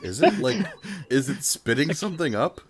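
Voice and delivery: laughing, high-pitched question; amused disbelief. Real, not acted. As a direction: ask it through laughter, voice up high. Laughing at the imitated creature noises.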